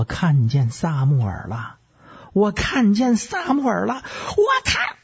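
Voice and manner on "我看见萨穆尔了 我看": very high-pitched frenzied screech; sudden piercing high cry; shrill shriek. Acted. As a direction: shriek it like a medium seeing a ghost. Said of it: "再加上嗷的这么一声尖叫".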